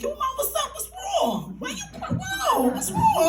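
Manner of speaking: very high-pitched imitation of worried men; hammed-up, squeaky concern for someone crying; alarmed bystanders. Two men alarmed at her sobbing.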